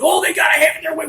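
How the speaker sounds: high, fast mock shout of their demands; mocking whine. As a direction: sneer it out as a parody.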